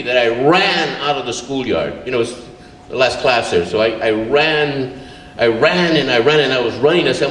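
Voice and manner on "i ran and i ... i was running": normal talking voice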